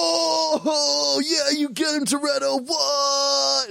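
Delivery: high, shouting background crowd; hyped crowd warm up. Retold or imitated. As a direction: cheer him on, way overhyped. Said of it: "all the guys in the background are like".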